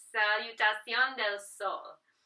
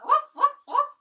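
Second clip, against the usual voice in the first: whoops; 13 semitones higher, 7 dB louder than usual. Pretend. Playful cheer in downward dog.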